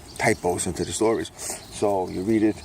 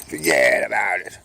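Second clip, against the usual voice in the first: Brooklyn catchphrase; 4 semitones lower, 5 dB louder than usual. Acted. Recurring catchphrase voice.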